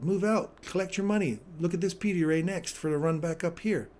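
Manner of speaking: loud, pumped play-by-play over the chart; hyped trader calling entries and exits live; live trade commentary. Real, not acted. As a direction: call each move like a race announcer.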